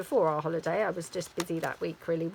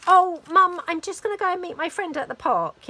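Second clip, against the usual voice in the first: high, loud breezy announcement; imitated daughter; 10 semitones higher, 8 dB louder than usual. Daughter telling mum she's off out, imitated. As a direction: put on a daughter's higher voice, casual and bright.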